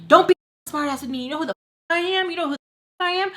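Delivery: high-pitched mock scolding; arguing mom. Mom arguing with smart-mouthed daughter, mock outrage. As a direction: imitate mom pulling rank, pitch climbing up high. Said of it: "So me and her are arguing".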